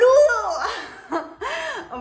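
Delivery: high, loud burst of laughter; a shrill, crying laugh. Real, not acted. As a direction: crack up at her own joke. After the che boludo joke.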